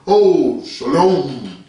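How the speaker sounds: mock pious deep greeting; put-on deep voice. Mocking believers who greet each other, put-on deep voice. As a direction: drop into a drawn-out, affected deep voice. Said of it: "put no deep voice on".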